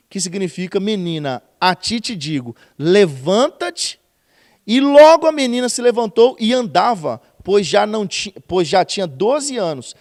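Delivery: loud, slow pulpit delivery of the miracle; loud preacher proclaiming the miracle; preaching at full force. Acted. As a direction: build it up for the congregation.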